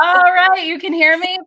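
high, loud and bright-voiced; loud excited check. Real, not acted. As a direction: call out to check she hears. Checking audio after reconnect.

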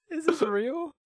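high, slow, laughing disbelief; asking through shocked laughter. Real, not acted. Reacting to absurd texts.

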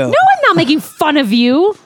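high, loud mock-protest; exaggerated high-voiced denial; teasing denial. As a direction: protest playfully, pitched well up.

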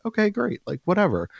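high, sing-song mock enthusiasm; sarcastic okay. To his wife about working out, high sarcastic brush-off. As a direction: brush it off with fake cheer. Mock-agreeing with wife's plan.

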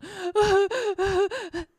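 sharp high dramatic gasp; theatrical gasp for breath; mock gasping sob. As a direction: fake a big sobbing gasp.

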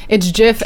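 "gif" said like "jif"; soft G. Shown as a demonstration. Arguing back at a friend, "gif" as "jif". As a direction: say "gif" with a J sound. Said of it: "The creator of the gif said it's gif".

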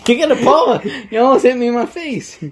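high, loud alarmed protest. Real, not acted. Friend almost hit him in the face, loud protest. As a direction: yell at him half laughing.

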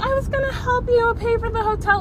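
high, mocking imitation of a friend; mocking whiny voice. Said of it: "but she's like".

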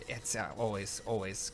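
a low, quiet sulk about losing; muttered grumble. Real, not acted. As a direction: mutter it under your breath. Mumbled grumble about the game.

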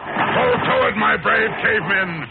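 high, loud, exultant shouting building to a cry; villain's rallying shout. Acted. Villain urges his cavemen to attack, exultant shouting. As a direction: whip the unseen tribe into a frenzy from afar.

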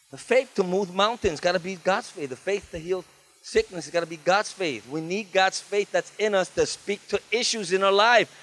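ordinary delivery

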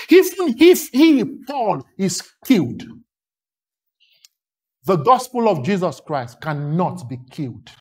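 normal talking voice

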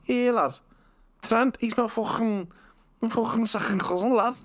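very high, hammed-up Scouse; Scouse accent. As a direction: put on a squeaky Scouse lad. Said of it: "if I had a Scouse accent".

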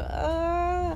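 drawn-out high wail of mock dread; high dismayed "ah". Shoplifter asked to follow the guard, high mock-dread wail. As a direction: let out a long, high "ah" of dismay. Guard tells her to come along.

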